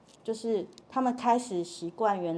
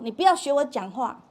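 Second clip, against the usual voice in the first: higher, louder mock objection; raised-voice protest; 6 semitones higher, 7 dB louder than usual. Child to parent echoing them, loud protest. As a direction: protest at being copied.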